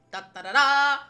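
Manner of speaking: ordinary delivery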